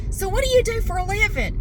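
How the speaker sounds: mock a prying small-talker; nosy chatty caller. Siding guy quizzing a customer, high mocking imitation. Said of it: "launches into just this series of questions".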